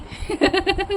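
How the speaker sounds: short, soft, high laugh. Real, not acted. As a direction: chuckle at yourself. Laughs at own mixing mistake.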